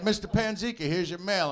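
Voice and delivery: high, friendly mailman greeting; a lightly voiced eager mailman; cheery mailman. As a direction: sing out the name like a chipper mailman. Mailman quote at the door.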